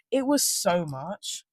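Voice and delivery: normal talking voice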